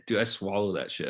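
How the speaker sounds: normal talking voice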